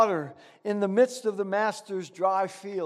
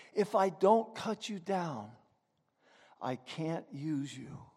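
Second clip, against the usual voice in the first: serious; 6 semitones lower, 7 dB quieter than usual. A narrator's reading. Master gravely explains to bamboo, softer and lower. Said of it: "the master's voice grew even more serious".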